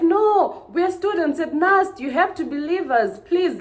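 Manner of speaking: high, loud frantic begging; desperate pleading. Retold or imitated. Girls begging the policeman to believe them, frantic. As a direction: beg the officer as if panicking.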